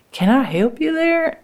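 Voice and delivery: high-pitched, careful offer of help; tentative offer. Acted. Offering help to brother's tired wife, tentative. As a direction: lift the voice high, politely offering.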